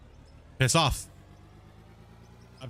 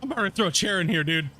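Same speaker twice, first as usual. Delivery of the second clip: higher and quicker, playful fury; mock rage; 5 semitones higher than usual. Real, not acted. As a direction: rant fast like you might snap. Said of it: "I'm getting all heated now".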